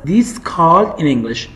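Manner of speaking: "what's this called in English" slow and clear; slow teaching repeat. Shown as a demonstration. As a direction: model the question slowly for the class. (آهسته و شمرده، مثل معلم)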